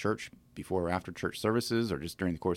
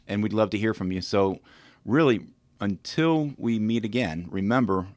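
louder, lifted announcer delivery; podcast sign-off; 3 semitones higher, 6 dB louder than usual. Acted. Host thanking listeners and plugging agents, announcer sign-off. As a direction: project the closing message to the listeners.

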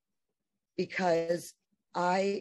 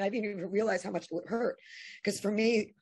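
ordinary talk, nothing marked

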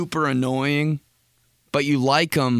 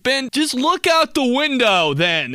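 higher, louder mock dad, annoyed; imitating his dad; 11 semitones higher, 5 dB louder than usual. Dad telling Ben to look out the window. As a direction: do a snappy dad voice.

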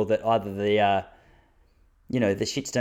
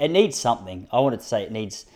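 normal talking voice

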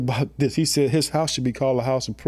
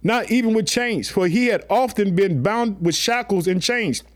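raised, ringing Bible reading; scripture reading; 6 semitones higher than usual. Acted. Preacher reading scripture to the church, raised and ringing. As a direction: read the verse up high to the congregation.

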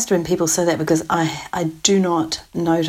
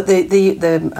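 ordinary talk, nothing marked

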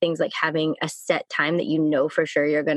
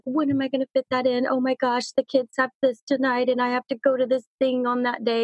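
frantic stressed quote; 6 semitones higher than usual. Retold or imitated. Mimics frantic overbooked parent.

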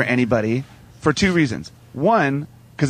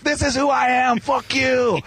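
defiant declaration; 10 semitones higher than usual. Retold or imitated. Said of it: "should have said".

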